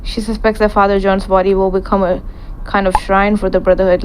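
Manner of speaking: normal talking voice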